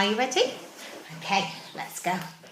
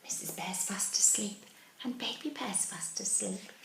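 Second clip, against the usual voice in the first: soft sleepy hush; 3 semitones higher, 7 dB quieter than usual. A narrator's reading. Sleeping bears, hushed.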